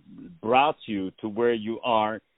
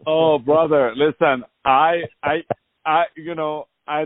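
louder, joking brush-off; hearty protest; 3 semitones higher, 5 dB louder than usual. Real, not acted. Host laughing off the guest's flattery, louder. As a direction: wave off the compliment loudly.

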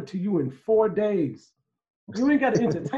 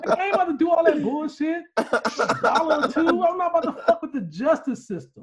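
high, laughing tirade; laughing, pitched-up rant about the justice system; 6 semitones higher than usual. Real, not acted. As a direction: laugh through the outrage.

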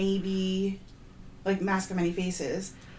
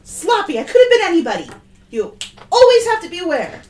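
loud, high-pitched sibling telling-off; scolding; 11 semitones higher, 11 dB louder than usual. Acted. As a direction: scold them like a drill instructor. Older sibling scolds sisters after drill.

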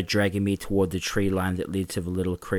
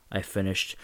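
ordinary delivery, nothing marked

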